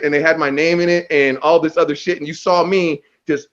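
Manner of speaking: normal talking voice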